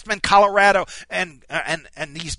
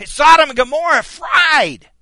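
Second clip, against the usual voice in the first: shout; 7 semitones higher, 7 dB louder than usual. Acted. Preacher recounting God's judgment.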